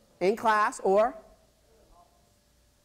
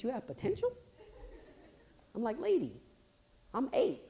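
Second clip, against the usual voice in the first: put-on incredulous kid; 6 semitones higher, 6 dB quieter than usual.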